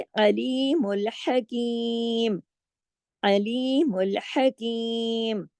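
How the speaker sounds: slow melodic tajweed chant; Quran recitation. Shown as a demonstration. Teacher recites the verse end for students, slow chant. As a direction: draw the words out like recitation.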